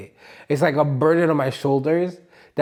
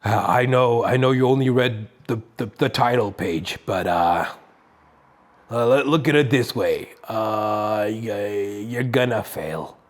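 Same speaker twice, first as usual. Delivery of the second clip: mocking defeatist voice; 4 semitones lower than usual. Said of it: "the hardest voice for me to defeat".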